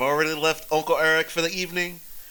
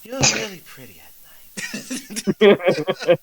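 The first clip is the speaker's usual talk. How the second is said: very slow, louder tipsy drawl; drunken compliment; 2 semitones higher, 5 dB louder, much slower than usual. Acted. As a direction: say it dreamily drunk.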